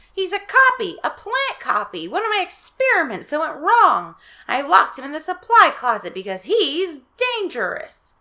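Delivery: high, loud, hurried pleading; urgent explanation. Acted. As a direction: convince the kids.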